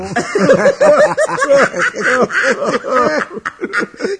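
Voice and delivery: high, loud burst of laughter. Acted. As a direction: howl with laughter at the story. Laughing at the rejection story.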